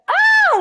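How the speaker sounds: high, loud shriek of pure joy; a burst of happy screaming; joyful shriek. Retold or imitated. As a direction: let out a delighted scream. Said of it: "you're just like".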